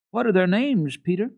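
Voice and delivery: put-on eager girl asking. A narrator's reading. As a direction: Heidi asks the goat boy their names. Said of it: "she asked".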